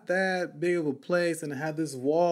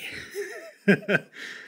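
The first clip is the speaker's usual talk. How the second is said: high squeaky laugh. Real, not acted. Laughing at his own story.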